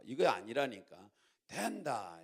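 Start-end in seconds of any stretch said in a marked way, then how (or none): 1.5-2.2 Gyeongsang lilt, first syllable of 된다 stressed; accented Gyeongsang version. Shown as a demonstration. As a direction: show them the Gyeongsang accent. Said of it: "여기다 악센트를".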